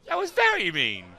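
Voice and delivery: high, loud outraged squeal; high shocked protest at a mean takedown. Real, not acted. Reacting to violence.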